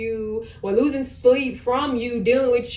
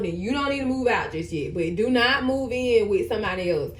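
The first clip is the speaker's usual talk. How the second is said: ordinary talk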